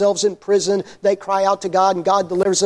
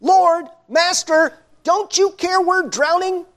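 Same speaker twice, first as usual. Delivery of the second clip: much higher, drawn-out panicked plea; frightened pleading; 9 semitones higher, slower than usual. Retold or imitated. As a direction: beg him desperately over the storm. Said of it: "And they're screaming at him".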